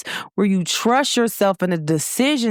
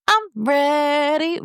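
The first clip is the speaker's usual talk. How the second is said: high, stretched-out "I'm ready"; playful drawl; 6 semitones higher, much slower than usual. Acted. Telling listeners she is ready, playfully high and drawn out. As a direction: draw out "ready" high, rising at the end. Playful take, then plain repeat.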